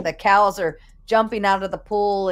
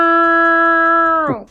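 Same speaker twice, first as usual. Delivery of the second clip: long, high, loud moo; imitated cow moo; 11 semitones higher, 8 dB louder than usual. Moo for donor Milk Cow.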